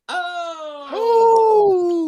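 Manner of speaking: high loud Oh of shock; shocked cry. Real, not acted. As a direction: yelp in mock horror. Reaction cry.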